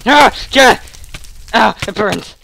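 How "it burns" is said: small mock whimper. First, small cry while burning.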